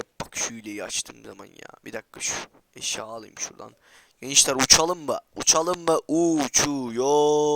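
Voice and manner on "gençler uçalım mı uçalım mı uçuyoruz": loud call ending in a long-drawn word; excited hype call. Real, not acted. Gamer asking viewers to fly, excited and drawn out. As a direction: hype it up, stretching the last word out. Hyping viewers before a flight.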